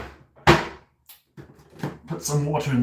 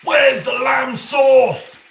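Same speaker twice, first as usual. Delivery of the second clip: slow, furious shouted chef rant, much higher and louder; Gordon Ramsay impression; 12 semitones higher, 9 dB louder, much slower than usual. Asked by chat, streamer does Gordon Ramsay furious shout. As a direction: roar it like an enraged TV chef. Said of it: "a Gordon Ramsay impression".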